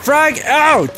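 high, loud grenade callout; callout shout. Real, not acted. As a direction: bark it out like a soldier. Throwing a grenade.

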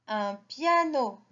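ordinary talk

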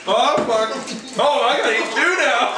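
high loud laughing exclaim; excited outburst. Real, not acted. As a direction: blurt it out, laughing. Penalty for swearing.

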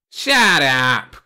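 loud snapping shout; angry shout. Acted. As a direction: bark it at him. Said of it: "cried the Cruv".

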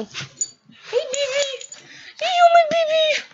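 squeaky-sweet pet voice. Real, not acted. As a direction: coo at your dog in a high voice. Cooing to the dog.

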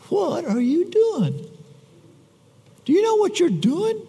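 high, slow incredulous challenge; high-pitched disbelieving rebuke; men of Judah scolding. Retold or imitated. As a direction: scold him like an exasperated crowd. Men of Judah confront Samson.

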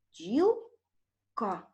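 бджілка in two slow, separated syllables; split into syllables. Shown as a demonstration. Teacher dividing бджілка for pupils, split into syllables. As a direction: break бджілка after бджіл, pause before ка. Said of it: "Поділимо перше слово на склади".

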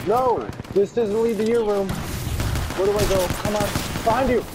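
high alarmed yelling; frantic, lost and rushed; panicking gamer. Real, not acted. Lost while enemy attacks.

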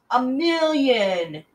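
kicking the ball away, yelling a million; yelled. Acted. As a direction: stretch it out slowly and yell. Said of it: "yelled Terrence".

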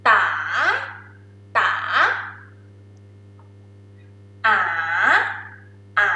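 "pa, ka, ta, a" each dip, then go up; rising tone, song-like. Shown as a demonstration. Teacher drilling Thai rising tone, song-like "pa, ka, ta, a". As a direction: sweep "pa, ka, ta, a" upward. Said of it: "go up".

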